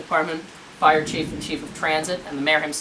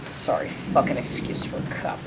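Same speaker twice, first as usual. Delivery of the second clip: low, near-whispered jibe; muttered insult; 5 semitones lower, 4 dB quieter than usual. Acted. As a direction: mutter it under your breath, barely voiced. Muttered insult at departing officer.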